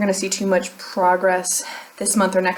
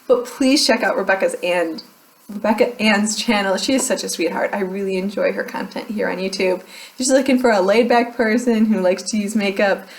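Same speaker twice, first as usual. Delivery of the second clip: normal talking voice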